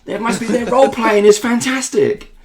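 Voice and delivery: high-pitched mock gushing; imitating excited admirer. Player quoting a wife's praise of the role play, high excited imitation. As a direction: marvel like an impressed listener. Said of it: "his wife was listening".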